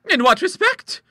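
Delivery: very high, loud query; astonished question. Acted. Sister querying the hostess's claim, astonished. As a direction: ask it in amazement.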